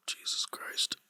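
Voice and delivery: exasperated breathy whisper; weary whisper. Real, not acted. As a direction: whisper it, worn out by the team. Dreading talk about the loss.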